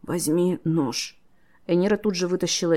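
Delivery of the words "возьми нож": slow, laboured croak; wounded man straining to speak; hoarse croak. A narrator's reading. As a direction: croak it word by word, in pain. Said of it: "Тейп прохрипел".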